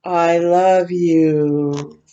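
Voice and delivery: put-on reading a child's first words with love; slow tender. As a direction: draw it out gently like a precious first word. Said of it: "he does say".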